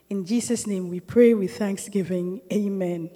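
gentle prayer of thanks. Acted. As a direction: pray to the Father in a hushed, humble way. Closing prayer before the teaching.

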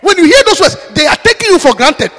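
loud, high, rising preacher's shout; preaching at full force. Acted. Preacher shouting warnings to the congregation. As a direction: drive it home to the congregation.

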